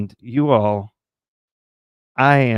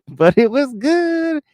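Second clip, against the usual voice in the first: much higher, drawn-out GOOD; playful high voice; 12 semitones higher, slower than usual. Pretend. Streamer excusing a broken diet, playful high voice. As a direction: squeak it out slowly, stretching GOOD.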